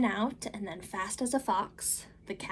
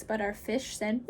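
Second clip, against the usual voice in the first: ordinary delivery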